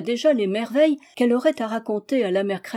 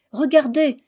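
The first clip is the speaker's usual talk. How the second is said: higher, louder eager exclamation; excited cry; 5 semitones higher, 5 dB louder than usual. A narrator's reading. Bébé spotting the woodcutter, crying out with excitement. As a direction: call out delighted at the sight. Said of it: "s'écria Bébé".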